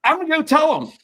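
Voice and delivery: high, loud, fired-up threat; indignant vow. Retold or imitated. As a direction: announce it like you're marching off. Contestant storms off to tell.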